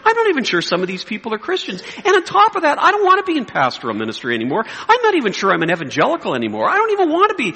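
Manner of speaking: high-pitched, exasperated venting; fed-up rant. Retold or imitated. Pastor venting to himself about his critics, fed up.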